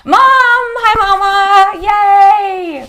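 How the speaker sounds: very high, loud squeal of delight; sing-song Mama, thrilled to see mom; overjoyed greeting. Real, not acted. Mom joins the live stream.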